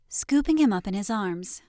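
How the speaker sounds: usual speaking voice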